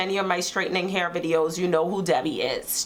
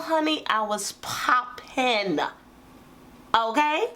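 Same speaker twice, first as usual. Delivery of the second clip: sassy; 3 semitones higher, slower than usual. Real, not acted. Sassy brag about hairstyle.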